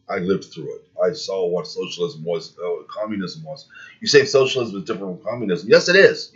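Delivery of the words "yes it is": higher and louder, insisting; emphatic yes. Real, not acted. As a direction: snap it back with force. Insists against opponent.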